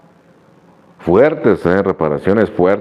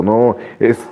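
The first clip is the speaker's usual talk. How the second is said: usual speaking voice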